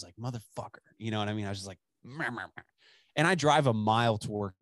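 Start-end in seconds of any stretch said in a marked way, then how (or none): 0.2-0.8 muttered grumpy curse under the breath; soft disgusted swear at gas prices. Retold or imitated. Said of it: "I was all grumpy". 2.0-2.6 soft mocking meh meh meh; grumpy grumble. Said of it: "I was all grumpy".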